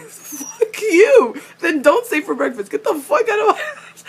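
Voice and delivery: loud, high, mean mock snarl; meanest voice ever. Fan voicing Alec telling Magnus to get out, mean mock snarl. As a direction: spit it out as meanly as possible, laughing. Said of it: "like, meanest voice ever".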